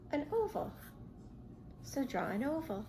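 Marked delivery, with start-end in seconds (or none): none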